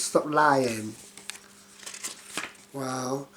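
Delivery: usual speaking voice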